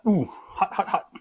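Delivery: talking around a hot bite; puffing over hot food in the mouth; mouth full of hot food. Real, not acted. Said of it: "It's still hot".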